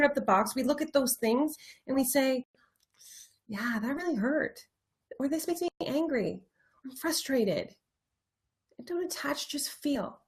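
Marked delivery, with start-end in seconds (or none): 3.5-7.8 soft, inward owning of feelings; quiet admission. Acted. Models admitting feelings to oneself. 8.8-10.2 near-whispered, very soft instruction; hushed guidance. Real, not acted.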